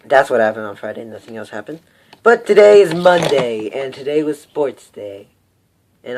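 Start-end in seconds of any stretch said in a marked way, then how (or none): 2.2-5.3 loud, slow, dramatic announcement; big announcement. Real, not acted.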